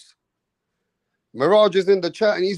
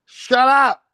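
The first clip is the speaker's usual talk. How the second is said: higher, louder dismissive yell; mock angry yell; 7 semitones higher, 4 dB louder, slower than usual. Imitating the guest shouting at Mirage, mock yell. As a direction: shout him down as the rival. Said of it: "He said".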